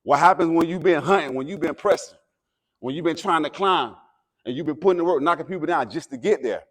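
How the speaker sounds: rising, loud motivational preaching; halftime pep speech. Acted. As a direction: fire up the team before the game. Escalating pep speech to team.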